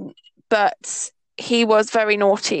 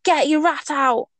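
higher, drawn-out mock catcall; imitated schoolboy catcall; 4 semitones higher, slower than usual. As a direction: call it out like lads at school. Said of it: "in the Geordie accent that I'm not gonna do".

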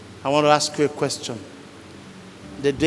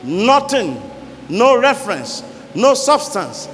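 loud, higher preaching climax; full-force preaching; 4 semitones higher, 3 dB louder than usual. Acted. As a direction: hammer each phrase at the congregation.

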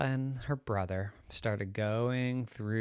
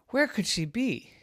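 worried parent; 11 semitones higher than usual. Acted. Parent searching.

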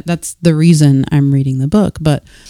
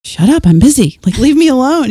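mock irritated brush-off; 8 semitones higher, 4 dB louder than usual. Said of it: "I'm just like".

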